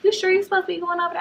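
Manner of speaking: high, fast, doubting granny voice; suspicious granny. Retold or imitated. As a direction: grill the kid like a wary grandma. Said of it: "She was like".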